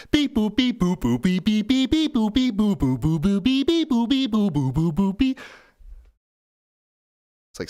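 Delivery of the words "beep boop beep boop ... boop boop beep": high, erratic beep-boop run; imitated loud beat. AI playing a longer beat, high full-volume imitated beeps. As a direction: mimic a crude synth at full volume. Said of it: "you got loud again".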